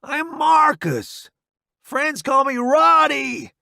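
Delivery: high, loud cocky soldier; a grinning, reckless army buddy; brash soldier voice. Acted. Roddy voiced by narrator.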